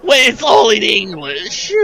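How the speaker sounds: mocking indignation. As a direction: parody the huffy line. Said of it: "it's so weird to be like".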